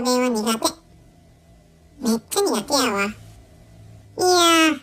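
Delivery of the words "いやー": long drawn-out いやー, louder and higher; exasperated groan. Real, not acted. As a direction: groan it out, worn down by the dialect. Exasperated sigh at Osaka dialect. (うんざりした長いため息まじりの声)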